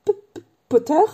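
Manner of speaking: high voice catching on the first sound; nervous stammer. A narrator's reading. Nervous professor seizing Harry's hand, stammering. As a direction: stutter the name out, rising. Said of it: "balbutia le professeur".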